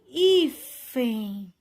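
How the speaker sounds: hífen slow, with HÍ loud and held; penultimate stress demo. Shown as a demonstration. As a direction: punch and stretch HÍ in hífen. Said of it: "paroxítonas terminadas em N".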